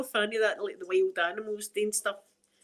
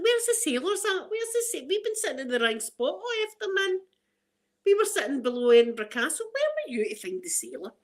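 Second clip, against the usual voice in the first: eager sailor hunt; 9 semitones higher than usual. Retold or imitated.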